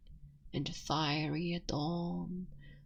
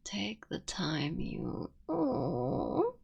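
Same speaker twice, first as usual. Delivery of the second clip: voice breaking; slower than usual. Acted. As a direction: whisper that cracks into a sudden cry. Emotional breaking point.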